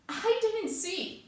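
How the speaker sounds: high, drawn-out plea; distressed denial. Acted. Sonny insists to police she saw nothing, pleading denial. Suspect repeats her denial.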